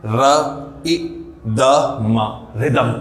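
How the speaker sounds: र इ द म spaced apart, then रिदम said whole; sounding out. Shown as a demonstration. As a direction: sound out each piece, then blend it into रिदम. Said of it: "तो इसको क्या बोल देंगे?".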